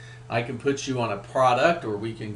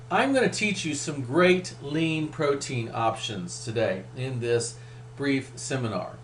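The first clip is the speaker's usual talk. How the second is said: normal talking voice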